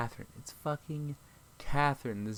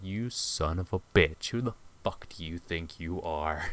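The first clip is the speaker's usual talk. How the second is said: menacing; 7 semitones lower, 4 dB quieter, slower than usual. Retold or imitated. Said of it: "I'm like".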